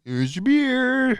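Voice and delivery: very high, slowly stretched mock-cheery call; put-on enthusiasm. Asked for enthusiasm like the show's opening, hammed-up excited cry. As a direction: sing out with hammed-up excitement, pitch soaring. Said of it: "Let's have some enthusiasm".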